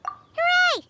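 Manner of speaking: cheer. Acted. Paimon cheering.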